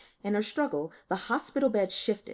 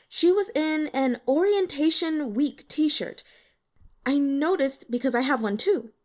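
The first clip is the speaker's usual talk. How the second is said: put-on higher, louder young woman, hesitant and eager; nervous student girl; 6 semitones higher, 4 dB louder than usual. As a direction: play the shy student a bit higher and louder. Voices the student Li Tian.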